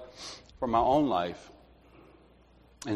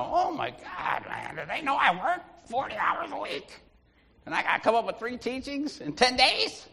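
high-pitched, incredulous complaint; overwhelmed protest; 10 semitones higher, 5 dB quieter than usual. Retold or imitated. Man complaining to Donna about three teachings, overwhelmed protest. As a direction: whine it high in mock dismay.

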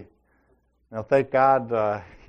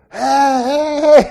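yelling; 14 semitones higher, 13 dB louder, slower than usual. Retold or imitated. Stranger yelling at gas station.

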